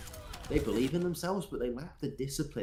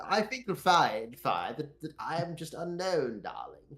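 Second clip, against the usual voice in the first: Unknown; 4 semitones higher than usual. Pretend. Pretend player correcting the caster Fire, hammed-up posh English. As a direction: correct them with affected rich-English grandeur. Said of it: "can we get posh Unknown".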